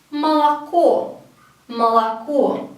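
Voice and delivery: "молоко" as ma-la-KO, slow and deliberate; vowel reduction. Shown as a demonstration. Teacher modelling the word for milk, "молоко" said ma-la-KO. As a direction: stress the final O of "молоко", A in the first two. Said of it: "pronounce the first two as A".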